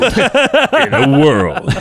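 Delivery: high and very slow, laughing; movie trailer voice. Shown as a demonstration. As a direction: trailer line breaking into laughter. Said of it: "do your movie voice".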